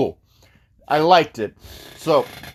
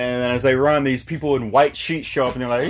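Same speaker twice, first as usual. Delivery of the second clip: ordinary talk, nothing marked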